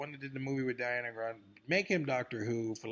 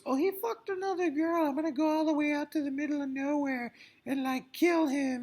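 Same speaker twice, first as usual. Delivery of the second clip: much higher, mocking a jilted woman; mocking girly voice. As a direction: squeak it out like a silly heroine. Mocking the show's heroine.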